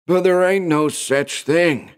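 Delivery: put-on high, slow, forceful old man's voice. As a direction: put your foot down, old-timer. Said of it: "said Jed Hoskins's old man forcefully".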